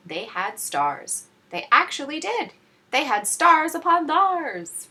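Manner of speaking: amazed reveal. A narrator's reading. Astonished reveal.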